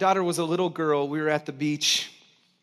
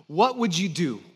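usual speaking voice

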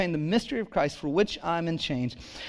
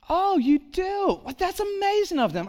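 gushing lady; 13 semitones higher than usual. Retold or imitated. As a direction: gush like a delighted older lady. Lady at estate sale voiced.

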